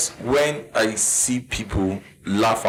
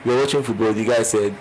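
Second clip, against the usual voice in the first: ordinary delivery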